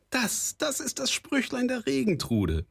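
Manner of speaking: very high-pitched exclamation of recognition; excited cry. A narrator's reading. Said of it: "rief Frau Stine". (aufgeregter Ausruf in sehr hoher Stimmlage)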